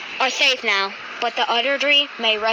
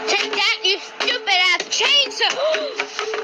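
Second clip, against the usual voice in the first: battle cry; 6 semitones higher, slower than usual. Acted. Striking the chainsaw.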